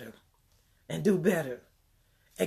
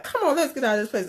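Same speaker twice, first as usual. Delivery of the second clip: upset urging; 7 semitones higher, much faster than usual. Retold or imitated. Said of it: "She got so upset".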